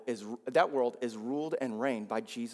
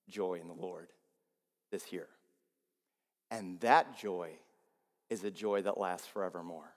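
hushed intimate preaching; 4 semitones lower, 4 dB quieter than usual. Acted. Pastor speaking tenderly to the church about lasting joy, hushed. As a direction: draw them in close and quiet.

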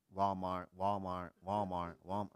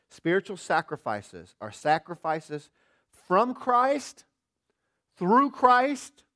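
much higher and louder preaching build; climactic preaching; 7 semitones higher, 12 dB louder, much slower than usual. Acted. Preacher's closing cry to the church, much higher and louder. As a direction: ring it out to the rafters.

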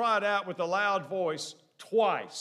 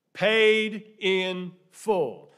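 very slow, loud, word by word; emphatic proclamation; 3 semitones higher, 7 dB louder, much slower than usual. Real, not acted. Preacher revealing what was written at the bottom, slow proclamation. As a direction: stretch out each word for weight. Climactic translation of Tetelestai.

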